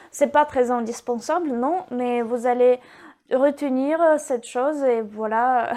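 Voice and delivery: ordinary talk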